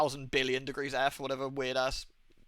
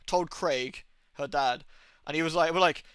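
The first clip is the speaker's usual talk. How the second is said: ordinary talk, nothing marked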